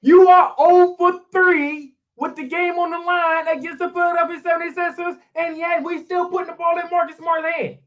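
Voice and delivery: high, loud, angry rant at the team; frustrated yelling. Real, not acted. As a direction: vent it all out, fed up. Exasperated rant about last shot.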